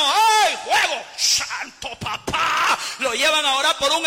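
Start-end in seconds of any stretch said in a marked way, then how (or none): 0.0-2.8 hammed-up ecstatic shouting, last words drawn out; mock an over-fired believer yelling praise; frenzied worship cry. (gritos exagerados de fervor)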